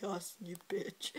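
soft unvoiced whispered insult. Sister whispering an insult at her brother, whispered. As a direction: hiss it under your breath. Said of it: "I'm like".

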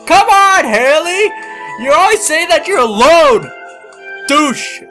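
loud high yelling at Haley. Acted. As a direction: shout it at her, high and loud. Voicing characters yelling at Haley.

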